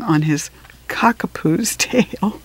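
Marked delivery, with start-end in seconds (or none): none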